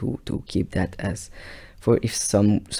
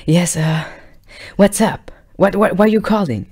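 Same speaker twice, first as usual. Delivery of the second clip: put-on girlfriend on phone; 9 semitones higher, 7 dB louder than usual.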